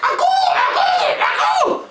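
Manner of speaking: very high, loud, slow chanting call; aku signal call. Shown as a demonstration. Player performing his secret signal, slow shrill aku call. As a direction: howl it out like a jungle bird. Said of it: "I'd start making my signal".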